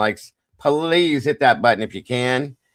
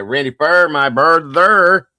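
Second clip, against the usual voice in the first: loud, slow and high shout-out; loud drawn-out greeting; 6 semitones higher, 10 dB louder, slower than usual. Real, not acted. Host hollering a welcome to a chat viewer, loud and drawn out. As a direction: stretch the name out big and loud. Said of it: "I don't know, I can't talk today".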